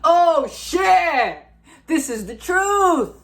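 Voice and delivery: high, loud sudden realization; excited shout. Retold or imitated. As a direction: shout it out in revelation. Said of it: "You're like".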